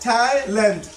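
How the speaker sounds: ট্যালেন্ট split into two separate syllables; teacher counting out the two syllables of ট্যালেন্ট. Shown as a demonstration. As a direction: say ট্যা, then লেন্ট, with a small break. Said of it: "এটা হচ্ছে অবশ্যই ডি syllable".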